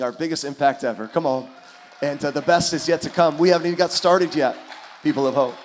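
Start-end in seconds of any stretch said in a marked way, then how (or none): 2.0-5.5 louder, fired-up preacher; rally the church; preaching. Acted. Preaching builds to shouts.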